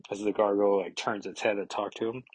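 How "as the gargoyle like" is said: ordinary talk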